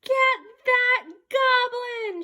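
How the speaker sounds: rally the mob after him; angry crowd shout. Acted. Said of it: "shouted everyone".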